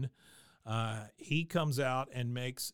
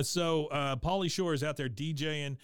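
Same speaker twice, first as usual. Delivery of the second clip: ordinary talk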